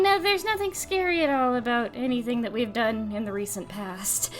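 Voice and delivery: low, quiet, sinister denial; spooky deadpan innocence; ominous low voice. Pretend. Joking about eating people.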